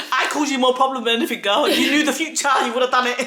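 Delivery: laughing. Real, not acted. Joking to mum, laughing.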